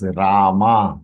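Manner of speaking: "Rama" said as "Raa-maa"; wrong long ending. Shown as a demonstration. Teacher quoting how some people say "Rama", long last vowel. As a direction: hold the final a of "Rama" long. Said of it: "That is wrong".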